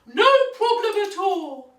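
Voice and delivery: high, loud doggy voice; woofing dog. A narrator's reading. Dog offering the duck his letter, woofy high voice. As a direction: offer it like an eager barking dog.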